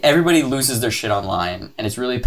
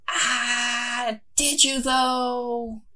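high, soft, slow mock doubt; quietly sarcastic, stretched out; 12 semitones higher, 6 dB quieter, much slower than usual. Real, not acted. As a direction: drip with disbelief. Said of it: "sceptical aside".

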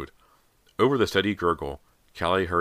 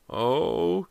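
high, rising groaned oh; 8 semitones higher than usual. A narrator's reading. Girl sick at the sink's smell, groaning. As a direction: groan out a higher-pitched oh. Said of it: "Kelly groaned".

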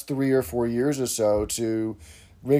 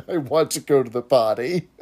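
talking doll. Retold or imitated. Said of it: "the doll goes".

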